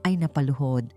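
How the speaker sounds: plain reading voice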